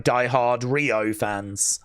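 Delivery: ordinary delivery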